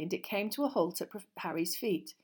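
ordinary delivery

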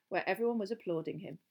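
plain reading voice

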